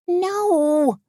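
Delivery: very high cry of protest. A narrator's reading. Wife objecting to husband's driving plan, crying out high. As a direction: cry it out high without much volume. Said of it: "Tony cried".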